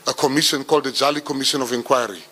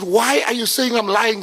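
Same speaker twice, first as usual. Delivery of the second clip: high, indignant protest; a son protesting to his mom, much higher; 8 semitones higher than usual. Retold or imitated. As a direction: push back, hurt and high.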